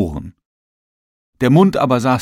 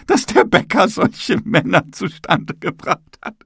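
high-pitched character voice, hesitant at first, then rising higher; high, excuse-making ceiling getting more worked up; apologetic talking ceiling; 10 semitones higher, 3 dB louder than usual. Acted.

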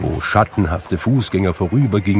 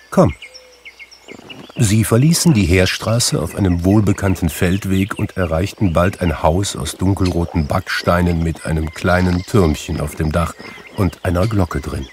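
ordinary delivery

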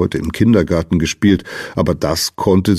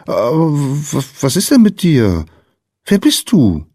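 put-on higher, halting child's questions; little girl's voice; 8 semitones higher, 3 dB louder than usual. A narrator's reading. Lina questioning the strange little visitor, higher hesitant child voice. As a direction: stumble into it, pitched up like a child.

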